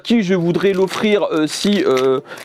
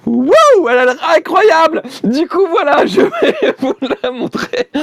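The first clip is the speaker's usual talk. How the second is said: thrilled; 13 semitones higher, 6 dB louder, slower than usual. Real, not acted. Thrilled at the figurine.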